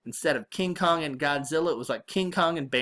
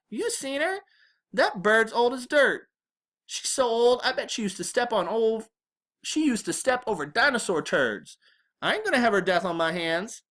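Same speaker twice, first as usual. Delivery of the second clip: put-on high-pitched, exasperated teen protest; incredulous older brother; 8 semitones higher than usual. A narrator's reading. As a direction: protest in disbelief about the old woman. Byron mocks grandma's age to brother.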